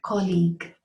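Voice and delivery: "colleague" as KOL-eeg, stress on the first part; careful teacher model of "colleague"; colleague said right. Shown as a demonstration. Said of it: "the right way to say it is".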